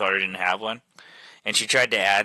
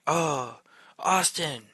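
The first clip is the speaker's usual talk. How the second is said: high, soft mock call from a distance; imitated calling out; 6 semitones higher, 4 dB quieter, much slower than usual. Neighbor calling him over the backyard fence, imitated calling out.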